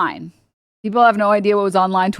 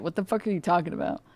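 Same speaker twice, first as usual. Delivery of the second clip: ordinary talk, nothing marked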